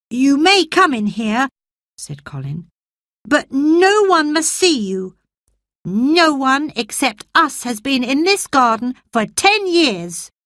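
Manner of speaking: put-on a frail boy promising himself he'll live; sickly boy Colin.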